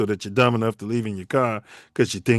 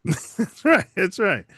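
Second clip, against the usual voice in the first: laughing; 9 semitones higher than usual. Real, not acted. Laughing agreement with cohost.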